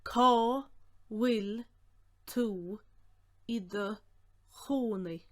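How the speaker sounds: one word at a time, strong first word; each word sounded out alone; slow breakdown. Shown as a demonstration. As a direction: dictate slowly with clear gaps.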